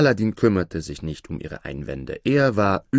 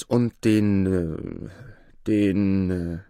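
drawn-out "den", stuck on "äh"; halting "den, äh, den, äh", waiting for a prompt; hesitant groping; much slower than usual. A narrator's reading. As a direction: stall on "den, äh", searching for the word. King gropes for the prompted word.

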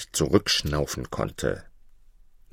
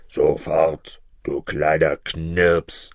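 low, slow giant threat; threatening giant; 3 semitones lower, much slower than usual. A narrator's reading. Giant orders the little shepherd about, low and threatening. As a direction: threaten low, dragging out the insult. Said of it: "drohte der Hühne".

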